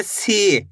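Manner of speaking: सी with a long ई; drawn-out see. Shown as a demonstration. Teacher showing सी with long vowel, stretched. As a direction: hold the ई of सी. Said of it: "सा को भी खींच के पढ़ेंगे".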